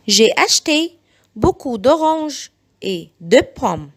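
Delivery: very slow, each syllable spelled out; slow it right down so parents can copy; slow model sentence for learners; slow teaching read. Shown as a demonstration. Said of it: "بعيد الجمل بالراحة جدًا".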